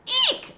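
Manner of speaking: a tiny frightened mouse squeak, very high. A narrator's reading. Humphrey thinking the ghost took the boys, a frightened squeak. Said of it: "I squeaked".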